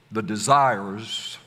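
desires slow and rising, the plural ringing out; stress on desires. Shown as a demonstration. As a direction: stretch out desires so the plural s is heard.

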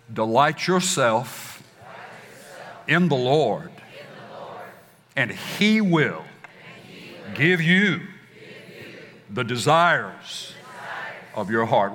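call-and-response swell, leaning on Delight, He and desires; building emphatic recitation. Shown as a demonstration. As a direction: pastor leading the congregation in a rising, stressed recital. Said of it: "emphasis is gonna be right on the right syllable".

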